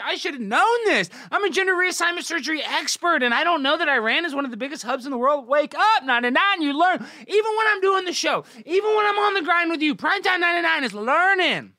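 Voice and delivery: high, loud self-hyping brag; mock hype shout. Streamer bragging to chat about being an expert, shouting. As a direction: shout your own genius at the chat.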